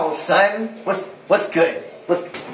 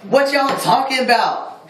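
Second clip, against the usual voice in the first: high-pitched hyped bragging; triumphant "gotcha" outburst; gleeful reveal; 9 semitones higher than usual. Real, not acted. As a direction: crow it high and excited, rubbing it in. Prank reveal of own bathroom.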